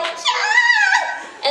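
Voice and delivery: very high, loud yelp; a shrieking cheer way up at the top; Noelle. Retold or imitated. Noelle cheers just before she dies, in a very high, loud yelp. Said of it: "And then Noelle would be like".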